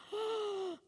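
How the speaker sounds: long, high, soft gasp; gasp of wonder. Acted. Kids opening Christmas gifts, awed gasp. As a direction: gasp like an amazed kid. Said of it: "their big eyes of".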